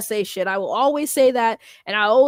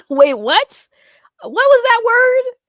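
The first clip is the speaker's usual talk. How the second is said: high, loud, incredulous question; laugh, then blurt it out in disbelief; baffled; 11 semitones higher, 7 dB louder, slower than usual. Real, not acted. Streamer puzzling over a strange word in chat, baffled.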